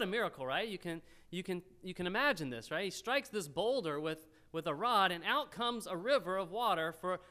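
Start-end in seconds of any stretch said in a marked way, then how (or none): none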